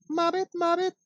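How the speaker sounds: very high squeaky frog voice; the mama frog's high croak. Pretend. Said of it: "Mama Frog goes".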